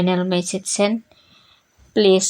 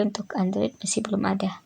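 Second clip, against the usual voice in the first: whispering; 9 dB quieter than usual. Real, not acted. Aside at end of explanation.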